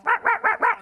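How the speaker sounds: very high, loud yapping; mock dog barking. Small dogs on the street yap, very high and loud. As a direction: yap like little shopping dogs.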